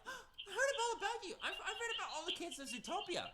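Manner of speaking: high, squealing and laughing; giddy fan squeal. Acted. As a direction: gush like an overjoyed fan. Fan gushing over Zootopia character.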